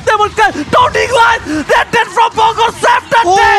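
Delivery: shouted hype play-by-play, high and loud; fighting game announcer. Real, not acted. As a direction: scream the big play like a caster. Caster hyping decisive team fight.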